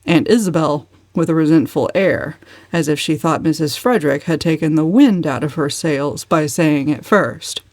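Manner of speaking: plain reading voice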